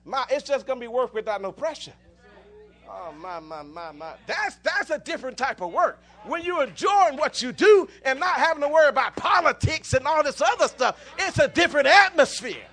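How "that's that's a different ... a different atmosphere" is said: much louder, rising preacher's call; preaching at full force. Real, not acted. Preacher driving the congregation, loud and building. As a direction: lift the whole church with it.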